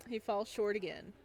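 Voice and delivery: ordinary delivery, nothing marked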